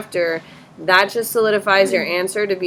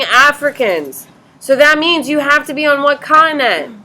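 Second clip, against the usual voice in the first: higher, louder, pressing the class; exasperated push; 7 semitones higher, 6 dB louder than usual. Real, not acted. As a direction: drive the class toward the obvious answer. Teacher exasperated, pushing class.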